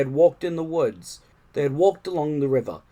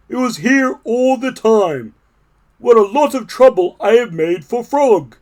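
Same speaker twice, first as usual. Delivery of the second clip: higher, louder sheepish toad voice; remorseful Toad; 8 semitones higher, 8 dB louder than usual. A narrator's reading. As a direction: Toad admitting his fuss. Toad realizes his mistake.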